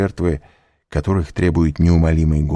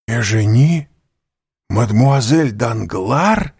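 протяжный изумлённый вопрос, выше обычного; спроси ошеломлённо, не веря себе; изумление после ужаса; 5 semitones higher, much slower than usual. A narrator's reading. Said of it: "прошептал Андреа, переходя от ужаса к изумлению". (изумлённый вопрос)

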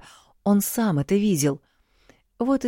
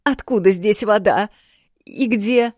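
distressed ghost; 5 semitones higher, 4 dB louder than usual. A narrator's reading. Ghost keeps lamenting, distressed.

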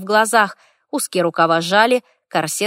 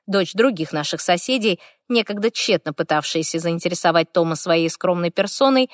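plain reading voice

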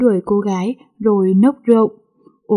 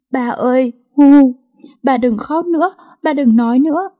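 sobbing; 5 semitones higher than usual. A narrator's reading. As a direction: break down crying over her father. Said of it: "Nó chỉ khóc và khóc". (khóc nức nở, giọng cao)

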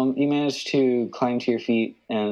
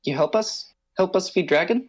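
high eager kobold asking; squeaky kobold voice; 9 semitones higher than usual. Acted. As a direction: chirp it like a little kobold.

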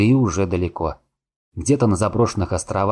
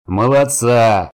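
loud, slow hearty dwarf praise; cheerful gruff dwarf; 2 semitones higher, 6 dB louder, much slower than usual. Acted. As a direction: boom it out like a jolly dwarf. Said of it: "весело выдал он". (весёлый громкий голос гнома)

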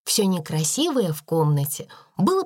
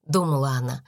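ordinary delivery, nothing marked

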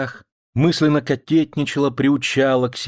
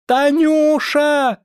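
high, drawn-out calling shout; frightened, piercing call; shrill cry; 5 dB louder, much slower than usual. A narrator's reading. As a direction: shriek the name out for help. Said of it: "довольно визгливым голосом закричала".

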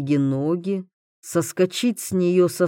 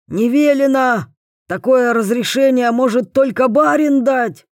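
high, loud, scornful retort; scornful snort; 7 semitones higher, 5 dB louder than usual. A narrator's reading. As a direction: snort it back dismissively. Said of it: "фыркнул приказчик".

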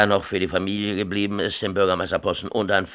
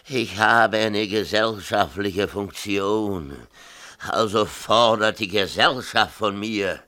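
ordinary talk

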